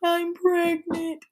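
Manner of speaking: high, slow tearful confession; crying while telling her brother she's pregnant; imitating crying. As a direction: squeeze it out high through tears. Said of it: "So I'm crying and I'm like".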